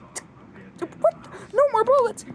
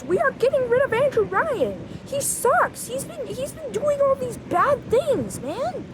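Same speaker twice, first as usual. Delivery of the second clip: ordinary talk, nothing marked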